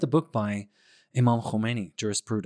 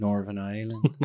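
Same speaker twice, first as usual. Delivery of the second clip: lower, softer mock Irish Northern Ireland; imitating Irish accent; 4 semitones lower, 3 dB quieter than usual. Host echoes guest's Irish pronunciation, mock Irish accent. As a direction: copy his Irish lilt on "Northern Ireland". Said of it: "The way you say that".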